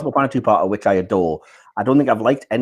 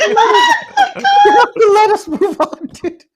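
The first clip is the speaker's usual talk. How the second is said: slow, high, breaking into laughter; laughing; 6 dB louder, much slower than usual. Real, not acted. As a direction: push the words out through giggles. Trying to continue while laughing.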